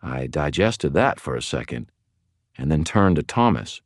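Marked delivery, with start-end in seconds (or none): none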